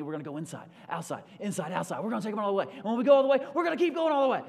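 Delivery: locker-room speech that ends in a full-throated rally cry; Rudy coach pep talk. Retold or imitated. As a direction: fire up the team at halftime, climbing to a yell. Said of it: "He goes into coach mode".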